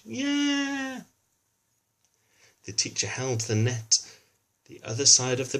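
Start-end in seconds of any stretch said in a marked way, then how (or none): 0.0-1.0 high, sung-out yeah; high cheer. Acted. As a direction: cheer like the fish was caught. Cheering as fish is caught.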